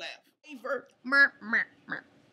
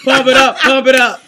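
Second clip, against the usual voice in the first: rhythmic crowd-pumping shout; hype man; 7 dB louder than usual. Shown as a demonstration. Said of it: "I'm gonna be the hype man".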